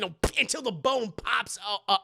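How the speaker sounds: ordinary delivery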